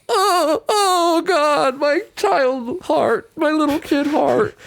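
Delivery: very high, slow, hammed-up weepy voice; mock childhood sobbing. Recalling watching as a child, mock sobbing high. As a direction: whimper it like a kid who is crying. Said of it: "I was like".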